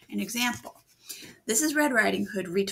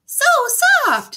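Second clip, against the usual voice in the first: squealed tiny firefly voice, louder; 14 dB louder than usual. Acted. Firefly squealing about her grass house, tiny voice. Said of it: "a tiny voice".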